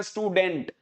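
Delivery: स्टूडेंट with a full DENT ending; common mispronunciation. Retold or imitated. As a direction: mimic स्टूडेंट as 'stoo-dent'. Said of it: "लोग इसको बोलते हैं". (स्टूडेंट, 'डेंट' वाला गलत उच्चारण)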